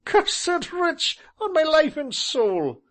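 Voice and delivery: high, loud laughing cry; delighted cry. Acted. Tall gentleman crying out in glee. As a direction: crow with delight. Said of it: "cried the tall gentleman".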